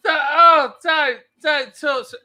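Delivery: slow, high, hammed-up scolding; mock coy protest. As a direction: play scandalized and flattered, drawn out. Mock coy fending off admirers.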